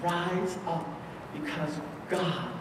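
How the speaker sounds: soft, low, halting reassurance; tender divine address. Acted. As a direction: let it sink almost to a hush, pausing between phrases. Preacher voices Jesus tenderly to a child.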